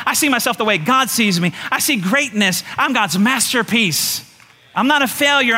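driving, emphatic preacher's cadence; fervent pulpit affirmation; declarative preaching. Real, not acted. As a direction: proclaim it with conviction.

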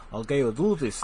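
mock Russian accent; put-on Russian accent; Russian hacker imitation. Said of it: "The Crazy Russian Hacker".

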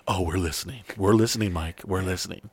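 spooky listeners. Retold or imitated. Female fans warning Mike, mock creepy low murmur. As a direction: menace the host in a low hush. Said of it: "sent me messages like".

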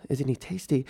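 quiet disbelief at what she just said; baffled repeat. Retold or imitated. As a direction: repeat "Isn't he tasty?" as if you can't believe it.

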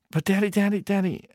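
put-on high childish protest; whining little boy. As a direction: nag your dad like a small kid. Boy pleading again with father.

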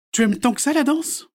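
high, loud, kind question; Sophie's voice. A narrator's reading. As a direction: ask the girl gently curious. Sophie asks the girl. (voix aiguë et curieuse de Sophie)

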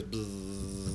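long, drawn-out buzz; sustained buzzing noise; imitated buzzing. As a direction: mimic the mystery buzzing sound. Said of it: "we hear this buzzing sound".

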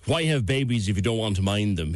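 ordinary delivery

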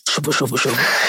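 low mock whisper of a deep voice. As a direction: fake a sultry whisper. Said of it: "let me try with you now".

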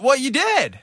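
high, indignant retort; mock outrage. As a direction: snap back, high and offended.

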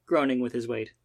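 plain reading voice

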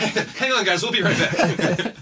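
mock sign-off, laughing and slow. Real, not acted. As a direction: fake break announcement through laughter.

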